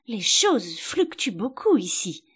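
plaintive. A narrator's reading. Alice complains about the shop's shifting goods, plaintively. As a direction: draw the words out in mild complaint. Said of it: "d'un ton plaintif".

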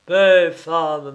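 loud barked command; sharp shout. A narrator's reading. Narrator silences his aunt, loud sharp shout. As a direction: cut her off with a shout. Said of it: "m'écriai-je".

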